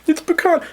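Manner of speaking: "pecan" said high, squeaky, pee-can; "pecan" jumps way up in pitch; pronunciation. Shown as a demonstration. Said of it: "okay, pecan".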